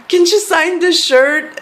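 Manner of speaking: high, loud, drawn-out plea; excited fan request. Retold or imitated. Asking her idol's fiance to sign.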